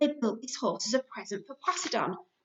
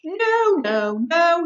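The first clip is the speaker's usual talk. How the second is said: loud whiny protest; complaining; 8 semitones higher, 10 dB louder, much slower than usual. A narrator's reading. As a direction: wail the no's like a cross child. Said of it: "he complained".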